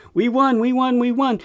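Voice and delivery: imitating insistent victory claims, pitched high; mock triumphant insistence; emphatic boasting. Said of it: "keep after emphasizing to the public".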